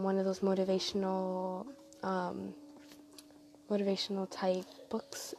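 normal talking voice

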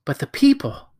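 a horrified gasp of a question. Acted. Boy horrified at the dead people, gasping. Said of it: "gasped Diggory".

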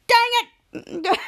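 high, loud mock frustration; exasperated outburst. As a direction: yell it like you've been pranked. Pranked Captain Man curses.

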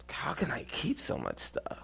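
unvoiced whisper of an inner thought. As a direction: whisper it like a secret to yourself. Quoting thought in your mind.

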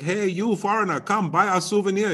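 high, loud mock vendor's call; acted street-seller pitch, high and loud; imitating souvenir seller. Voices a souvenir seller to foreigners.

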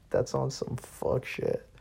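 breathy giggling words; slow, laughing it out; said mid-giggle. Real, not acted. Still laughing at the story.

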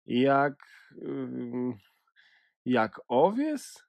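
a touch of hurt sadness, slow and hesitant; sad. A narrator's reading. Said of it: "ze smutkiem w głosie".

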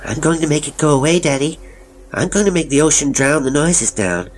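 put-on high, chanting child's voice; high-pitched childish incantation; disturbed little boy. A narrator's reading. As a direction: chant it like an odd small boy, easing down toward the end.

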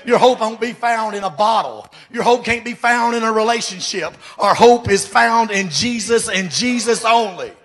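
fervent preacher's cadence; preaching. Acted. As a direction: preach it out to the church. Preaching to congregation.

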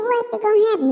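high dog voice. Acted. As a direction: squeak the question up high. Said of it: "He said".

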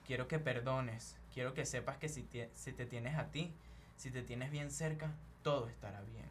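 hushed, deliberate words of comfort; radio inspirational reader. Real, not acted. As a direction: read it like a soothing radio reflection. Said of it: "Esa vaina pareció un toque de luz, el de la radio".

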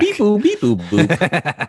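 high, slow robotic beeping; alien voice. Pretend. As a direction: beep like a robot. Censoring robot noise.

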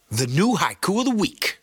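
hyped game-show announcer; segment announcer. Pretend.

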